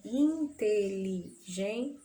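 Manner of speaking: in-te-li-gen-te, very slowly, syllable by syllable; separação silábica. Shown as a demonstration. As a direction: break inteligente into syllables with small gaps. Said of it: "separação silábica". (inteligente bem devagar, separando as sílabas)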